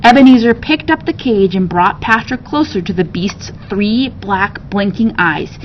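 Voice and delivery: plain reading voice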